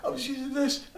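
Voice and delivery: put-on a caught kid blurting excuses in panic; the boy pleads with his angry mum, high and scared; panicked kid. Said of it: "he was like".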